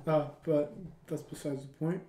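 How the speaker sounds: ordinary talk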